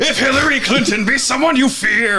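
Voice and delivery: high shouted captain chant; bellowed parody chant; pirate captain. Pretend. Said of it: "parody of intro chant".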